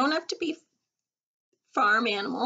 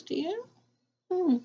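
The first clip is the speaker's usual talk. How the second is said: ordinary talk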